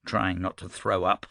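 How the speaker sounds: ordinary delivery, nothing marked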